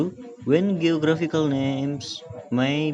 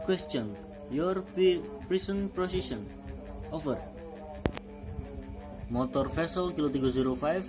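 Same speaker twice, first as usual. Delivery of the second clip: calm radio operator call; marine radio; 9 dB quieter than usual. Shown as a demonstration. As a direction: read it like a VHF radio exchange. Radio call demo dialogue.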